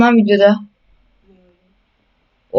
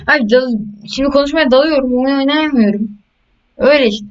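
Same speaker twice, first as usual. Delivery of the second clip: worked-up chatter; 6 semitones higher than usual. Real, not acted. Rambling, can't play.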